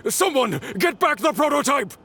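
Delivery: rally the henchmen; commanding call. Acted. Boss calling his men to grab the prototype, urgent command.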